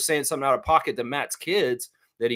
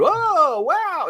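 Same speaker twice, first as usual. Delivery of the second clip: high, held-out wow; a goofy wide-eyed kid voice; mock awed kid; 5 dB louder than usual. Imitating a weird little kid in a meme, high and drawn out.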